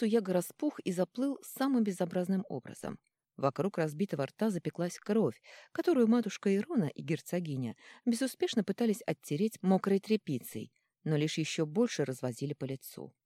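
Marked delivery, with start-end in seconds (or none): none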